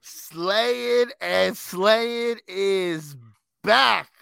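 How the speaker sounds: big slow hype announcement; drawn-out showman announcer. Acted. Announcing the holiday series.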